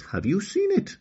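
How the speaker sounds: put-on high rising girl's question; girl asks the queen, high and rising; little girl Okani. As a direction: ask it hopefully, brightly. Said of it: "answered Okani with confidence".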